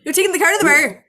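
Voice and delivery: hammed-up Newfoundland fisherman; Newfoundland accent. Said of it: "That's Newfoundlandese".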